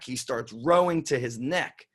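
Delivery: ordinary delivery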